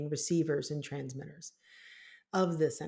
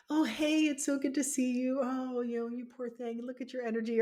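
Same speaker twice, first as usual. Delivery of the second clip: higher, sugary fussing over someone; mock gushing sweetness; 6 semitones higher than usual. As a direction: gush like a doting aunt, hammed up. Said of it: "it wasn't like".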